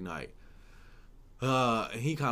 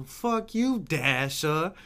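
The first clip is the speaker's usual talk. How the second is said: high, loud mock British-Boston accent; mixed British-Boston accent; 6 semitones higher, 6 dB louder, much slower than usual. British homegirl picking on Dasher, high mock British-Boston accent. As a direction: mimic a Brit who lived in Boston. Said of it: "That's how she talks".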